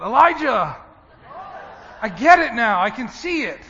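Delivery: loud, high burst of triumph; triumphant thought. Retold or imitated. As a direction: cry it out like a prophet who just won. Retold inner exultation like Elijah.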